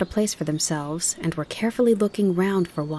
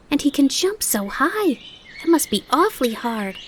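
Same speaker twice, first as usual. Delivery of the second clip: excited; 8 semitones higher than usual. Acted. Fawn gushes to his mother about the grasshopper, excited. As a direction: gush about the new friend. Bambi continues excited tale.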